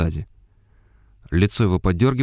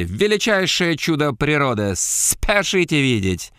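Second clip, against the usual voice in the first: booming circus ringmaster; 6 semitones higher than usual. Acted. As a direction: proclaim like a carnival showwoman. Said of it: "громко зачитала".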